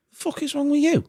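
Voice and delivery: high-pitched incredulous rant; acted high-pitched outrage; exasperated barman. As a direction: berate them in a high, indignant voice. Barman scolds scared roads in joke.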